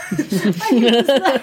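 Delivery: high, slow words through laughter; laughing. Real, not acted. Reacting to the doomed fleet story, laughing helplessly.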